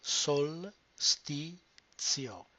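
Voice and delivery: very slow "Solstizio", each syllable split. Shown as a demonstration. Teacher breaking "Solstizio" into slow syllables. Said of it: "Break down the word into individual syllables".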